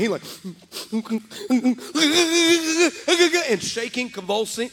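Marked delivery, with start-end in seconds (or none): 0.2-3.4 loud, high convulsive mock sobs; hammed-up blubbering, building in shakes; imitated crying. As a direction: imitate a big man breaking down in sobs. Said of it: "big guy in the chair starts crying".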